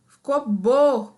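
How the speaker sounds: ordinary delivery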